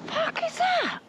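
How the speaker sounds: very high, startled exclamation; startled, pitch shooting right up; shocked alarm. Real, not acted. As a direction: blurt it out in real shock. Shocked discovery after the fight.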